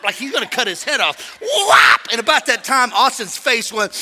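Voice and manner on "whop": high, loud whop; a big cartoon swing noise. Preacher mimics grandson swinging at his dad, loud high whop.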